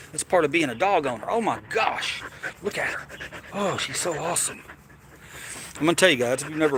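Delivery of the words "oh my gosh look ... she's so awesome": gushing over dog. Real, not acted.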